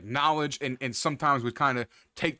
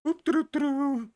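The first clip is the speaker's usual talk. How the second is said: high, carefree doo-doo humming; mock strolling tune; 11 semitones higher than usual. Teller hums Maddox's carefree stroll toward the evil thing, sung high. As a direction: hum a jaunty little walking tune.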